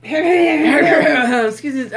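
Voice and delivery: coughing, throat still choked; choking. Real, not acted. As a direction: push words out past a cough. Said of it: "I'm just choking a little bit".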